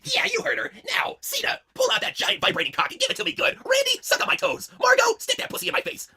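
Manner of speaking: high, breathy-sounding tease; high Stephanie voice. Acted. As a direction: purr it as a wicked hostess. Stephanie commanding lovers.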